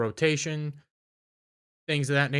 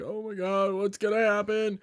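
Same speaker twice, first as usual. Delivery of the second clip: higher, panicky mock fear; mock terrified; 7 semitones higher than usual. Retelling Joel's fear, hammed-up high panic. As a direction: play it up like a scared dad. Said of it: "Joel's getting terrified, going like".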